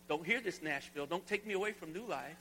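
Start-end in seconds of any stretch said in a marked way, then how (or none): none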